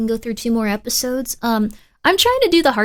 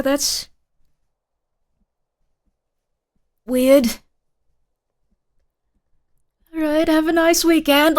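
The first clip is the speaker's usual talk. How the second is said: a light, higher sweet Martin voice; lightly voiced kind coworker; imitating Martin; 4 semitones higher than usual. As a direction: play him overly nice and apologetic. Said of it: "Martin is just very nice and went like".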